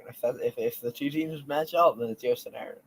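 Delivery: ordinary talk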